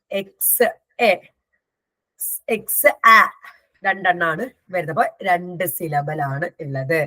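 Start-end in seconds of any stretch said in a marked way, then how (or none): 0.1-3.2 "എക്സ് എ, എക്സ് ആ" slowly sounded out in parts; teacher splitting "എക്സ് എ, എക്സ് ആ" with pauses; broken into syllables. Shown as a demonstration. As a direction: separate the vowel sounds in "എക്സ് എ, എക്സ് ആ". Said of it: "two vowel sound ആണ് ഉള്ളത്".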